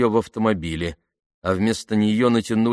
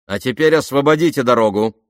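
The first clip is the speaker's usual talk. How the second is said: loud, raised order; commanding; 5 semitones higher, 7 dB louder than usual. A narrator's reading. Disguised officer orders soldier off the road, commanding. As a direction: order them aside with authority.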